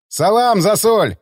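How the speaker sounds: loud friendly hail across the yard; calling out a greeting. A narrator's reading. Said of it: "крикнул Полукров".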